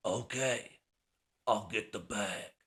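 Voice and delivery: lightly voiced action-star impression; terse tough-guy line, slightly put on; Jason Statham. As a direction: toss off an action-hero impression. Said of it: "Jason Statham".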